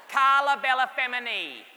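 proud Italian-accented Carla Bellafemini; Italian pronunciation. Shown as a demonstration. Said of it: "how Italian".

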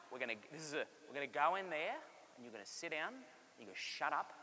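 a mum crouching to lecture in a low hush; hushed stern mum. Retold or imitated. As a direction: mum hushes her son outside the cathedral, stern and soft. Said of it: "she'd get down like this".

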